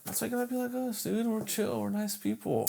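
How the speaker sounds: high, soft, sweet little voice; playful goody-goody voice; mock gentle. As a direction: play harmless nice guys, small and soft.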